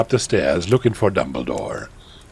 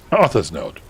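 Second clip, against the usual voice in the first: usual speaking voice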